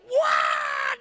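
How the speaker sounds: high, loud mimicked scream; imitated scream. Preacher acts out the screaming child his friend saw, imitated scream. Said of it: "Waktu dia lihat ini anak teriak".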